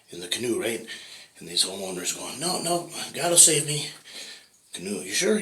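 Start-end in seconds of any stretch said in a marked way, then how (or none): 2.4-3.9 high, cheerful refusal; stubborn believer. Acted. Homeowner turning down the canoe, high and self-assured. 5.0-5.4 high, questioning double-check; doubtful rescuer. Acted. Canoe rescuer double-checking the homeowner, high and doubtful.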